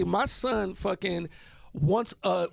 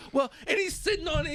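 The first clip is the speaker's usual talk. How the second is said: normal talking voice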